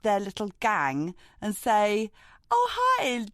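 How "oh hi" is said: high-pitched opening, quick casual request; pretend friendly approach. Stepping in to rescue a stranger, pretend friendly approach. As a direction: breezily feign knowing her. Acted intervention with the woman.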